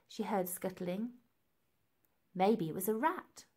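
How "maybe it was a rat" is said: ordinary delivery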